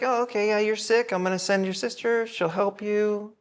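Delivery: clueless easygoing king. Retold or imitated. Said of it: "He's like".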